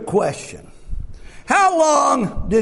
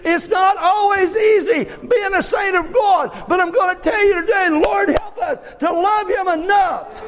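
full-force preaching; 8 semitones higher than usual. Acted. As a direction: drive it home to the congregation. Preacher builds again.

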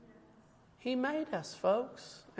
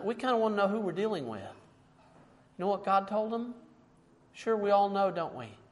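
ordinary talk

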